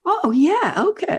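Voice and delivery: high, laughing "oh yeah, okay"; laughing mock realisation. Host imitating someone hearing their bio, laughing and high. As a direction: pretend to be pleasantly surprised.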